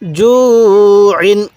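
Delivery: জু held long, ইন short; elongated recitation. Shown as a demonstration. Teacher showing how to read it, long জু, short ইন. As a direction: stretch জু, then close briefly on ইন. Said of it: "কীভাবে পড়ব?".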